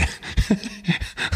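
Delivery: verlegenes, hauchiges Lachen; breathy laugh. Real, not acted. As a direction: lach leise über dich selbst. Embarrassed about honking. (verlegenes Lachen)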